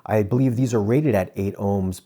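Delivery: ordinary talk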